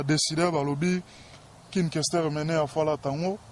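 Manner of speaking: ordinary talk, nothing marked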